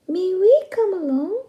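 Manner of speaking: high, loud pleading fish voice. Acted. The fish pleading with the rooster, high and loud. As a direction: beg to come along, up high. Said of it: "pleaded the fish".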